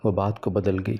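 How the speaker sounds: plain reading voice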